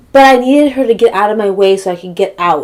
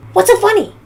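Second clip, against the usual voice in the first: high-pitched, loud mimicry of a confrontation; mocking neighbor; 12 semitones higher, 5 dB louder, faster than usual. Neighbor confronting her from the car, mocking high voice. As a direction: throw it out high, like a mocked aggressor.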